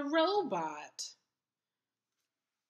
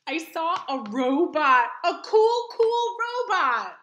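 loud, eager recounting; gushing about a cool robot; excited telling; 8 dB louder than usual. Acted. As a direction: burst out with the best part.

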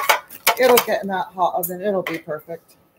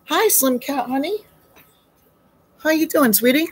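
higher sing-song greeting to the cat; pet talk; 6 semitones higher than usual. Real, not acted. Greeting Slim Cat, higher cooing pet talk.

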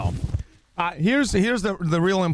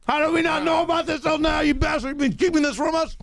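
shouted mock outrage; 7 semitones higher, faster than usual. Real, not acted. Host yelling at the crew for hiding the burger, mock outrage. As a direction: yell it like you've been betrayed.

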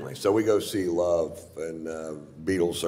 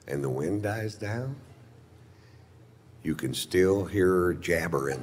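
spooky campfire tale. Pretend. Spooky legend telling.

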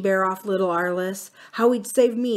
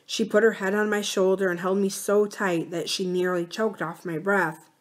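ordinary delivery, nothing marked

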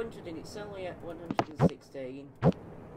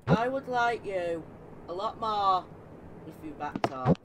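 loud plea; 4 semitones higher, 5 dB louder than usual. Real, not acted. Pleading with game enemy.

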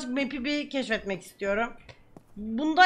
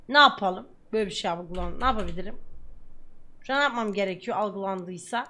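usual speaking voice

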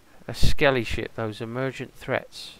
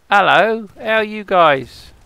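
loud, high-pitched hail; calling out; 8 semitones higher, 12 dB louder, slower than usual. Real, not acted. Player hailing strangers at sea, calling out loudly. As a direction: shout a drawn-out greeting across to them. Player hails others who turn up.

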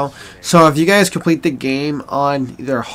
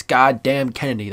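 usual speaking voice

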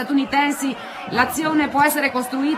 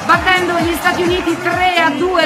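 excited victory call. Real, not acted. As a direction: shout the winning point with joy. Match point, Italy wins title.

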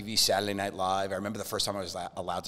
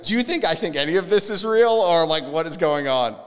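raised, loud disbelieving challenge; retold incredulity; 8 semitones higher, 11 dB louder than usual. Comedian retells challenging a prankster magician, incredulous. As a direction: call out the obvious setup, voice pitched up.

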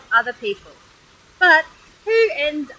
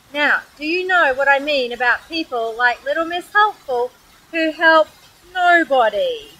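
plain reading voice

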